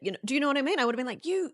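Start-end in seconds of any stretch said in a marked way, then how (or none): 1.3-1.5 mock berating parent. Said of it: "I would have been screamed at".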